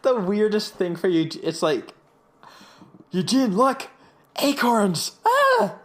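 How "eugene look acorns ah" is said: high, loud excited cry; excited mock shout. Friend retelling a scene, shouting out in mock excitement. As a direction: squeal it like a kid spotting treasure. S0 voicing Whit's excitement over acorns.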